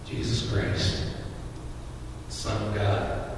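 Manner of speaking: breathy near-whisper, slow; reverent hush. Real, not acted. Preacher naming Jesus to the congregation, hushed and reverent. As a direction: speak the name in awe.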